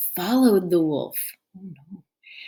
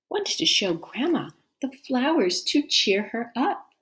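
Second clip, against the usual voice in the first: ordinary delivery, nothing marked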